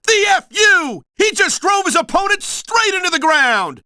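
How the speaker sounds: high, rising wrestling commentary; excited ringside call. Acted. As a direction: call the finishing move as it lands. Commentator calls the big finishing move.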